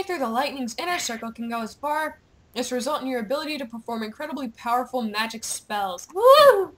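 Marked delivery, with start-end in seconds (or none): none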